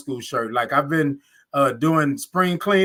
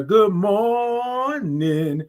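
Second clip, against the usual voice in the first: "morning" held very long, high; drawn-out good morning; 5 semitones higher, much slower than usual. Real, not acted. Host greeting all the viewers, "morning" drawn way out. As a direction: stretch "mor" into a long high note, then land "ning". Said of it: "all those O's and R's and I N G's".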